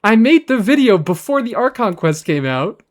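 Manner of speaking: high, loud mock outburst at the commenter; exasperated retort. Streamer retelling a reply to the commenter, in loud mock outrage. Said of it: "And I'm like".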